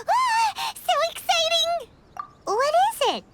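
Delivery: high, loud squeal of delight; thrilled squeal. Acted. Paimon imagines mysterious treasure in the notebook, squealing with delight. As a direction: gush like a kid about hidden treasure.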